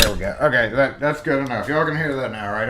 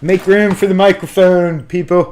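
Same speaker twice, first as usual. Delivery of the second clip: playful call-out; 7 semitones higher, 12 dB louder than usual. Pretend. Jokingly calling out for mic.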